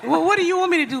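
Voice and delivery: ordinary talk, nothing marked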